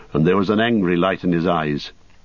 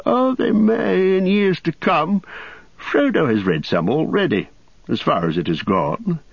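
higher hobbit voice; lightly voiced old hobbit; Bilbo voice; 9 semitones higher than usual. A narrator's reading.

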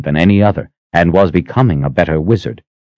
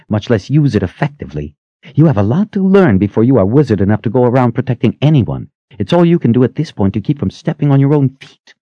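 heated, rising scolding rant; 9 semitones higher than usual. A narrator's reading. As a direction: tear into him with scorn. Scolding him, higher, faster.

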